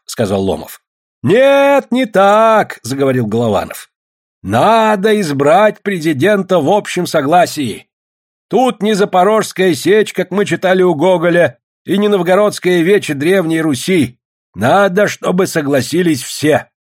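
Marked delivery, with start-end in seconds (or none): 1.2-2.8 high, forceful call over voices; loud objection. A narrator's reading. Golovanov interrupts the voting crowd, loud objection. 4.4-16.7 raised, deliberate meeting voice; public speaker. A narrator's reading. Golovanov urges the meeting to agree, oratorical.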